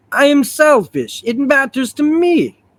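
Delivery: slow, very high cartoon hero voice; Colossus. Husband insisting it matters to him, high cartoon Russian voice. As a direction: draw it out high and hammy. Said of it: "I'm gonna do Colossus' voice from the animated series".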